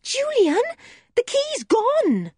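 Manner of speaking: put-on girl wailing through a locked door; crying out in dismay. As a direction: cry out in despair at the lost key. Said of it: "cried Joe".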